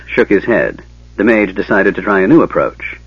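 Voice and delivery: plain reading voice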